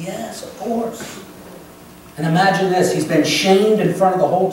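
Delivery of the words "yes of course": gentle Jesus reply. Acted. Jesus answers the repentant man.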